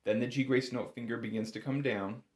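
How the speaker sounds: ordinary talk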